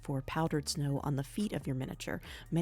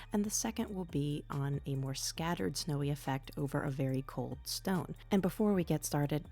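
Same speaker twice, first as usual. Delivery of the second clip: usual speaking voice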